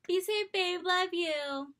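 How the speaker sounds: put-on high, loud, breezy girlfriend act; mock-loving send-off. Girlfriend texting boy before his mission, mock-loving send-off. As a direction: play along with exaggerated sweetness.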